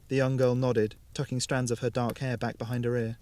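plain reading voice

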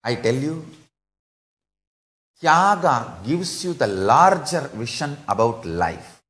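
normal talking voice

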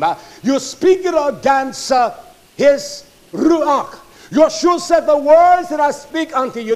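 loud, high, fired-up sermon voice; thundering pulpit delivery; full-force preaching. Acted. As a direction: preach it hard to the congregation.

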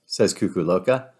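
usual speaking voice

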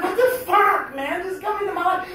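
high, loud burst of exasperation; exasperated outburst. Retold or imitated. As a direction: yell it in disbelief at the bee. Exasperated at the circling bee.

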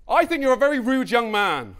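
indignant shout. Acted. Stranger shouting after him again, indignant yell. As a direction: scold a rude young man at full volume. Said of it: "run through it now with you".

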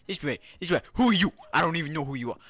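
mock confrontational. Player squaring up to strangers in game, confrontational. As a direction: pick a mock fight, punching each line out.

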